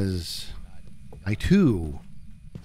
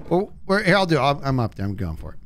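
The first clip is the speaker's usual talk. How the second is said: ordinary talk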